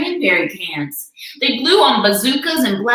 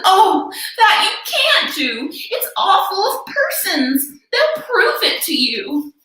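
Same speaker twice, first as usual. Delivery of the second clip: pleading in alarm; 9 semitones higher than usual. A narrator's reading. Elephant begging the kangaroo to spare the speck. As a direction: beg them to stop.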